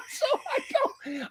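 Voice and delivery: breaking into high laughter. Real, not acted. Dad recalling the fight with his son, laughing high. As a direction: laugh through the words, going up high.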